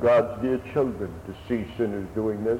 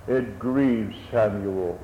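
grieved hush; 7 semitones lower, slower than usual. Acted. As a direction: let the sorrow sink on it grieved Samuel. Repeats the line, quieter and slower.

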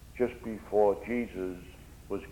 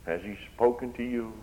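normal talking voice

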